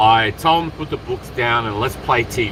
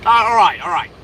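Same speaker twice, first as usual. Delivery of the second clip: usual speaking voice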